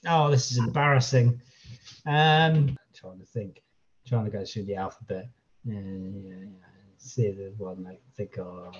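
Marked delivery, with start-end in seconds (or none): 3.0-8.7 low, very soft mutter to oneself; quiet, low thinking aloud. Real, not acted. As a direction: murmur your way through working it out. Thinking aloud over a quiz question.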